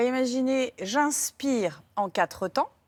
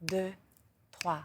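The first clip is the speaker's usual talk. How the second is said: guided breathing. Acted. Guides square breathing count.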